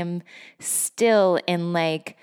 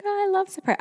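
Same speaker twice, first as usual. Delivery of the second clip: gushing delight; 14 semitones higher, much faster than usual. Retold or imitated. Excited reply to a gift.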